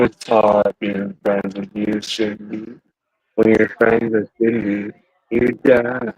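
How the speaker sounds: low voice-changer menace; Ghostface voice. Pretend. Taunting Cindy about being her friend, ghost face voice. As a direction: deliver a creepy phone-killer taunt through a voice changer. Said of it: "my ghost face voice".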